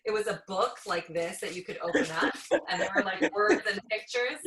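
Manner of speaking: amused laugh-speak. Real, not acted. Laughing about old magazine.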